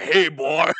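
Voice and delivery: loud, hammed-up American tough guy; manly eagle voice. Eagle with a gun greeting its victim, mock manly voice. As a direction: holler it like a mock macho eagle. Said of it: "would have a different voice".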